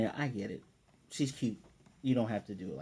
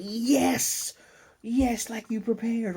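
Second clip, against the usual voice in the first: excited cheer; 11 semitones higher, 3 dB louder, much slower than usual. Acted. Acted cheering on the child.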